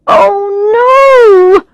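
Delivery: high, loud, drawn-out horror; dismayed cry. A narrator's reading. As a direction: cry out as the mistake dawns on you. Realizes she's in the wrong restaurant.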